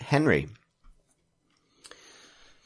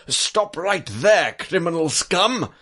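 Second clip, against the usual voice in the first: loud, high, drawn-out guard shout; guard's challenge; 7 semitones higher, 5 dB louder, slower than usual. Acted. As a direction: call out a halt like a game guard. Guard interrupts the acted story.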